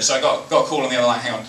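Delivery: ordinary talk, nothing marked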